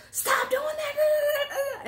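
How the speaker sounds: very slow, high, loud yell; retold yell. Father to son opening the van door, yelling. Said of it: "Yeah, I yelled at him".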